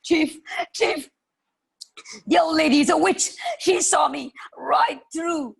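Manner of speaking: high, loud and fast panic; panicked report. Acted. Frightened robber shouts to his chief about the witch. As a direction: blurt it out to the chief in terror. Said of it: "ran to the hollow and said".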